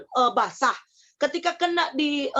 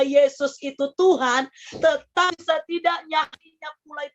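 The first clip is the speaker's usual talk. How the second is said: impassioned testimony prayer with long pauses; fervent prayer; 3 semitones higher than usual. Retold or imitated. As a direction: re-enact a heartfelt prayer, drawing out the words. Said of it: "Saya cuma bilang begini".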